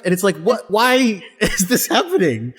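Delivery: usual speaking voice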